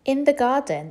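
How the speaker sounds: plain reading voice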